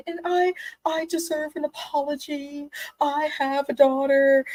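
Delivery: high, whiny put-on voice, slowed on daughter; mocking Angie. Recapping the reunion, mocking Angie's whine. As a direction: whine it out like a wronged victim. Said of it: "the way she said".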